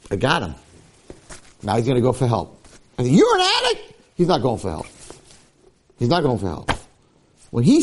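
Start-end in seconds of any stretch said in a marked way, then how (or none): 3.1-3.9 very high-pitched mock accusation; hammed-up confronting outburst; accusing. Shown as a demonstration. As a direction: squawk it as an incredulous charge. Mock accusation contrasted with self-admission.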